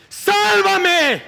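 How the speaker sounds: high, loud pleading cry; desperate shout. Acted. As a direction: scream it again as the water takes you. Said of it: "empezó a hundirse y a gritar".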